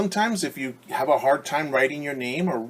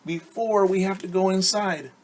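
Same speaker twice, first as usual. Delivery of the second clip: put-on much higher, slower child voice; eager children; 8 semitones higher than usual. Children beg the teacher for one more slide, high voice. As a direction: plead like a kid wanting more playtime. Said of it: "And they're saying".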